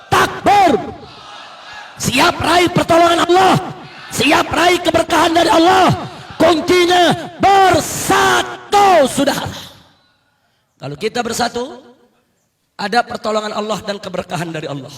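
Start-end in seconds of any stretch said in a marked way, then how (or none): 0.1-9.5 louder, higher rallying sermon, stretched shouted peaks; fiery preaching. Acted. Preacher rousing the crowd to unite, fiery shouted preaching. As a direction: whip the crowd up, word by word.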